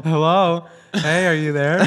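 loud, high mock phone check; calling out. Caller to a silent prankster, calling out. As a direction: imitate a caller hollering into a silent line, amused.

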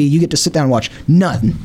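ordinary talk

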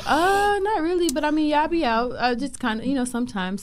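awkward deflecting. Retold or imitated. Retold awkward reply to friend.

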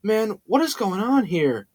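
slow, drawn-out bewildered question; low, dazed voice. A narrator's reading. Tyler sitting up bloodied and confused, slow and hushed. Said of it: "In a low voice, Tyler said".

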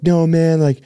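high, pleading voice of a desperate guy; distraught stranger. Retold or imitated. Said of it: "He's like".

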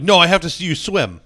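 loud, high imitation of an angry teacher; hammed-up exasperated insistence; mock mad. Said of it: "he would get mad".

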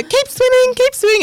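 high, singsong keep going; mock encouraging paddler. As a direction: imitate the chirpy paddler coaching. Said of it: "She, she go".

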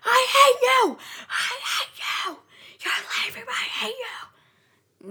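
high, strained mock tantrum scream; shrieked mock rage thinning to a hoarse squeal; acted-out anger. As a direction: throw a hammed-up fit, voice shooting up and dragging out. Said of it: "And then he gets angry".

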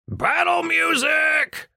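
high, slow stretched battle cry; yelled. Acted. Richter yells as he runs for the meeting hall. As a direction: bellow it out gleefully. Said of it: "Richter yelled".

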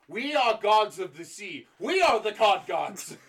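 mock-regal declaration; pompous British imperialist. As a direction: proclaim like a vain empire. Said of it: "where they're like".

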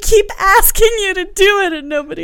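high, loud, fake sobbing plea; mock crying. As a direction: whine it like a fake cry.

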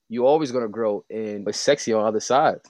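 ordinary talk

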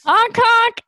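high silly honk honk; clown honk. Pretend. Clown honking intro.

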